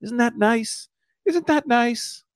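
very high, cooing question; mock coaxing. Co-host shows the algorithm the river, high mock coaxing. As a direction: coo it high as if soothing a creature.

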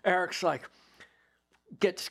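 ordinary talk

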